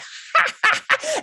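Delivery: breathy high-pitched giggle fit; high laughing fit. Real, not acted. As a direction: dissolve into giggles. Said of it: "dissolved into laughing fits".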